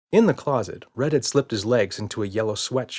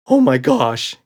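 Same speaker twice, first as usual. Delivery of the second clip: loud, higher gasp of fright; 4 semitones higher, 10 dB louder, slower than usual. A narrator's reading. Red panics about googly eyes, loud gasp. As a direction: gasp it in alarm. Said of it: "gasped Red".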